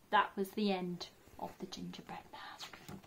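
whispered; hushed ending. A narrator's reading. Narrator whispering the sad end to children. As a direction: breathe the ending softly.

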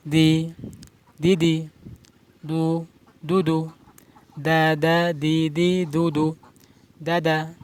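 teacher modelling light দ syllables one by one; light দ drills. Shown as a demonstration. Teacher drilling light দ syllables for students. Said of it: "একসাথে উচ্চারণ করতে হবে".

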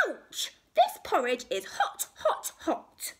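pained, high-voiced cub; Baby Bear. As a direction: cry out at scalding porridge. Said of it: "Baby Bear said".

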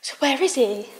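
normal talking voice

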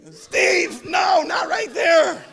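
high, loud scolding shout, drawn out; yelling at the dog. Retold or imitated. Owner yelling at the dog named after dad, shouted scolding. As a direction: holler at a misbehaving dog at full voice. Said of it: "Every time I yell at him".

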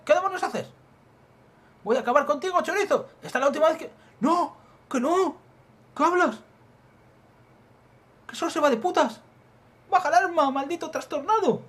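high, loud yelling match; acted frantic shouting. Armed confrontation with a thief, frantic shouting. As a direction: scream threats and protests in panic. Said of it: "deja de gritarme".

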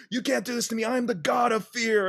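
high, put-on voice of a ranting prisoner; ranting villain. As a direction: whine it like a villain dragged off. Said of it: "and he's like".

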